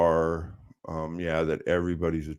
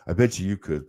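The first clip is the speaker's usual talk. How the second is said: usual speaking voice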